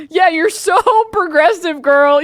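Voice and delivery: mock them with fake praise; sneering fake compliment; sarcastic mockery. Mocking a smug tweeter.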